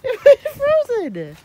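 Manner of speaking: slow, high, loud amazement; drawn-out amazed exclaim. Real, not acted. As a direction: stretch it out in awe. Repeats it, stretched out.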